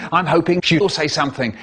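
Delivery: usual speaking voice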